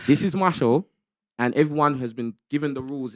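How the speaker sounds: ordinary delivery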